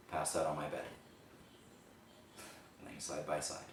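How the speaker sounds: hushed, halting monologue with long pauses; subdued, heavy storytelling, pausing between phrases; dramatic confessional monologue. Acted. Performs the rehearsed monologue.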